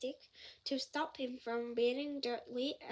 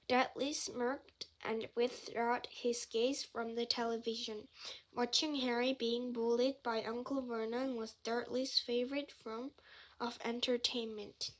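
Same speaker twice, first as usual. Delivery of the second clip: plain reading voice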